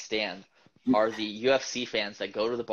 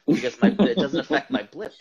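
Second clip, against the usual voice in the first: laughing; 5 semitones higher, 10 dB louder than usual. Real, not acted. Joking about his own privilege.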